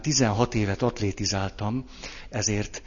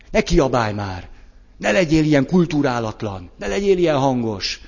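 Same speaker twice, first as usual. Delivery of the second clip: higher, louder mock scolding; shutting them down; 4 semitones higher, 5 dB louder than usual. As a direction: stop the other person from shouting. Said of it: "Vagy le akarlak állítani". (leintő rendreutasítás)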